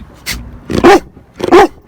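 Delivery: high loud laughing fit; burst of laughter. Real, not acted. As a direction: crack up helplessly. Laughing after saying cat.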